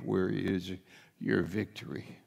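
ordinary talk

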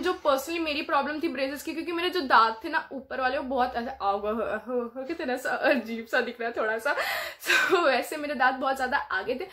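ordinary talk

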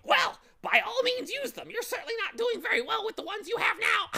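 very high, loud, quick bird voice; mocking bird. Bird making fun of Milo's words, high and loud. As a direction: needle him in a high squawk.

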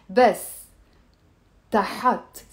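word by word with long pauses; slow teacher demo for repeating. Shown as a demonstration. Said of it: "Let me say it slow".

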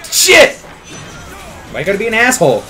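ordinary talk, nothing marked

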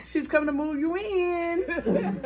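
crying with joy as big sister arrives; tearful surprise. Real, not acted. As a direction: mom welcoming big sister, voice high and teary with joy. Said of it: "Now you gonna have me crying".

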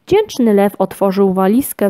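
ordinary delivery, nothing marked

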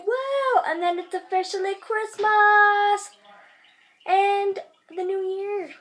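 high cheer, then slow, high, drawn-out words; thrilled the holiday is hours away; excited. Real, not acted. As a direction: whoop with countdown excitement. Excited about Christmas countdown.

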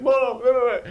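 high, loud hammed-up wail; mock crying. Auditioning son on the ground at mom's knees, mock crying. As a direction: imitate a tearful plea, played for laughs. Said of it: "I'm crying, and she's crying".

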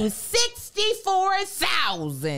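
slow, high announcer shout; drawn-out ad call. Acted. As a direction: stretch the number out like a jingle.

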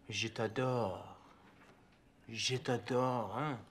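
Je t'adore murmured soft and slow, sliding into t'adore; seductive French. Shown as a demonstration. Tutor demonstrating to his pupil, softly seductive. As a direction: croon Je t'adore as if to an exquisite woman. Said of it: "say it as though you were speaking to an exquisite woman".

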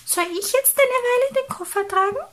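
very high, fast mouse voice; squeaky, loud little question; little mouse, offering help. Acted. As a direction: pipe up quickly as a tiny mouse.

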